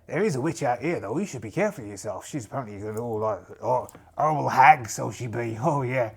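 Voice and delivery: hammed-up pirate, disbelieving and patronising; teasing pirate captain. Pirate warning a young woman about a witch, patronising role voice. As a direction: scoff fondly, then warn her like an old sea dog.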